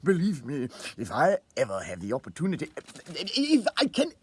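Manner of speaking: breathless laugh then halting thanks, climbing high and breaking off; stammering thanks. A narrator's reading. Grateful old clerk thanks his colleague, stammering and choked up. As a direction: stumble over the words, too moved to finish.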